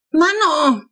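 high, loud startled yell; a little girl yelling herself awake; bellowing child. A narrator's reading. Little sister jolted awake by a bang, yelling. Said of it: "brüllt Flo".

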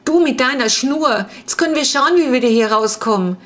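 put-on sulky girl whining at her brother; whiny reproach. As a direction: nag your brother for losing the string. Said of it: "knatschte Clara".

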